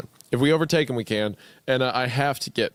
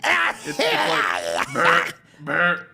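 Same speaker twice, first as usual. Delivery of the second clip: buzzy burr, burr cough; imitated insect cough; much slower than usual. Shown as a demonstration. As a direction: make a bug-like buzzing cough. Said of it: "I can't do it correctly".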